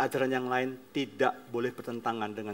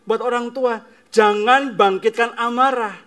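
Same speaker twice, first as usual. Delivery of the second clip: loud, higher, slow exhortation; forceful preaching; 9 semitones higher, 12 dB louder than usual. Acted. Preacher warning parents, at full force. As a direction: drive the warning home to the parents.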